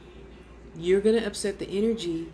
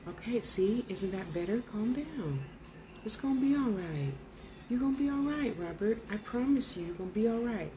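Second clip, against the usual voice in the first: much softer, tender promise; gentle reassurance; 2 semitones higher, 6 dB quieter than usual. Real, not acted. Medium comforting Robert's spirit before he fades, softly reassuring. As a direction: ease him with quiet comfort.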